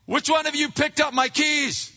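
put-on high, loud accusing yell at the kids; acted angry parent demanding answers; lashing out. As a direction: yell at the children like suspects. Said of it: "start lashing out at the kids".

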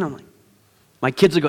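normal talking voice

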